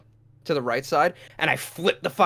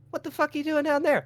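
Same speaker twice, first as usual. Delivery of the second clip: high, quick baffled question; acted confusion; 11 semitones higher, 4 dB quieter, faster than usual. Finding girlfriend under bed, acted high-pitched confusion. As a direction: imitate rage collapsing into bewilderment. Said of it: "rage to confusion".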